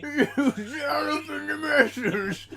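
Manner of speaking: high, garbled Cockney through laughter; strained mock Cockney. Mimicking the neck-straining man, high strained mock Cockney. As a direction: strain hard at a nonsense Cockney accent, laughing. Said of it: "strained his neck too hard speaking in Cockney".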